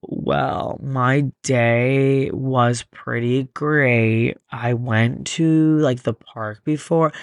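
very slow, drawn-out, boring delivery; mock slow talker. Mocking people who talk too slowly, dragging each word. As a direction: drag every word like you have all day. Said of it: "When people talk like that".

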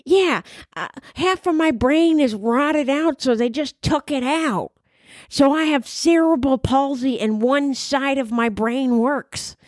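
slow, odd put-on voice of the teacher; Miss Neely's voice. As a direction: imitate the old teacher's slow, halting voice. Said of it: "That's how she sounded".

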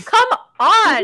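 loud, high exasperated outburst; exasperated disbelief. Real, not acted. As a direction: call it out, loud and incredulous. Exasperated at the fake accent.

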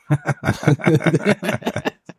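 quick amused laugh; short laugh. Real, not acted. Laughs after admitting visa motive.

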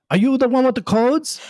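high, far-off Schwarzenegger-style accent impression; Arnold accent. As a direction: lean into an Austrian Arnold accent. Governor asks the aide.

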